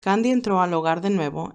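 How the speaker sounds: ordinary delivery, nothing marked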